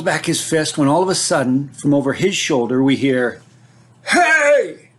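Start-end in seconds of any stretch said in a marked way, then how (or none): 4.1-4.9 sharp high yell from a distance; shouted call. Retold or imitated. Older brother calling to the bully as he rides up, high shout. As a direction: yell Hey to stop the fight. Big brother calls out arriving.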